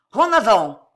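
loud rallying shout; yelled battle order. A narrator's reading. Cato launching her army, yelled order. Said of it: "hurla Cato".

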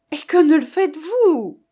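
high, loud outcry; exclaiming. A narrator's reading. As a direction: burst out with an urgent question. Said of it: "s'écrièrent à la fois mademoiselle Sophie".

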